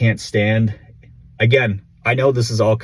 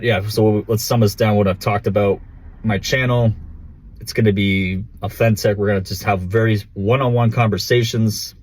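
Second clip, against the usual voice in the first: ordinary delivery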